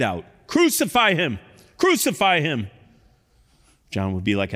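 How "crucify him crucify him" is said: high, loud demanding cry; angry mob outcry; crowd shouting. Acted. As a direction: shout for his death. Crowd crying out.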